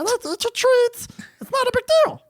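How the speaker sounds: squeaky, very high falsetto creature; placating little critter in strained falsetto; talking mongoose voice. Acted. Mongoose excusing himself for living in the walls, squeaky falsetto. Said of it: "what would this have sounded like".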